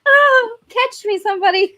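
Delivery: high breathy swoon, fainting damsel; damsel in distress. Retold or imitated. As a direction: swoon like a helpless heroine. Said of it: "they're like".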